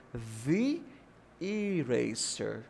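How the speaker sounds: slow THE with long ee before ERASER; THE rhyming with "see", then a slow ERASER; THE as "thee". Shown as a demonstration. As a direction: say "theee eraser", drawn out. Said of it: "porque começa com som de vogal".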